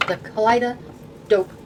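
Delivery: Kaleido Dope with a pause before Dope; split deck name. Shown as a demonstration. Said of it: "Not Kaleidoscope".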